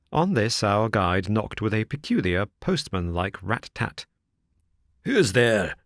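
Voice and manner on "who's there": lightly gruff porter calling through the door; a hint of a wary doorman calling out. A narrator's reading. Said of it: "cried a gruff voice".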